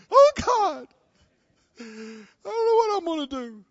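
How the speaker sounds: high, mock-tearful wail; despairing unbeliever. Preacher imitates how unsaved people talk, tearful wail. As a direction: cry out as if hopeless, half-sobbing. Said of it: "That's how unsaved people talk".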